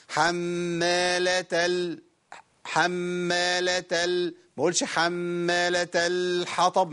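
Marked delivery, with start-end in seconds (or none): 0.1-4.3 drawn-out "حمالة ال" with long m and measured l; medium-held lam. Shown as a demonstration. Teacher modelling the middle-length lam, slow level "حمالة ال". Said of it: "توسط، يبقى أقول إيه؟". 4.8-6.9 slow recital of "حمالة الحطب" with overheld l; overlong lam, wrong. Shown as a demonstration. Teacher showing a timing mistake, "حمالة الحطب" with dragged lam. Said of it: "زودت كده الزمن".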